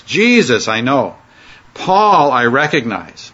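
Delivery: slow, leaning hard on "Jesus" and on "Paul"; emphasis on Jesus and Paul. Shown as a demonstration. Teacher slowly stresses "Jesus" and "Paul" for the class. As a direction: put the weight on the first word of each phrase. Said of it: "That gives it the emphasis".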